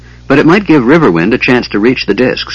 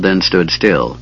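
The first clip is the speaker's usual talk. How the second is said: ordinary delivery, nothing marked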